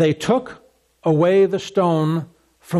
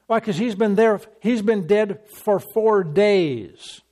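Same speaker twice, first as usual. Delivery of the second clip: usual speaking voice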